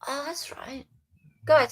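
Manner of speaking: ordinary talk, nothing marked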